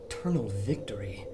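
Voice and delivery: low, soft repeated musing; muttering to himself. Acted. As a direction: puzzle it out under your breath. Pondering the riddle quietly.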